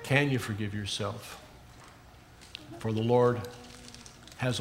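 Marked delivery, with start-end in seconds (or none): none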